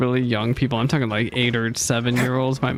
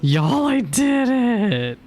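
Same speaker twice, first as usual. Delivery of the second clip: higher, slow triumphant cry; 10 semitones higher, much slower than usual. Real, not acted. Streamer finally solving the puzzle, triumphant. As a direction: crow the win.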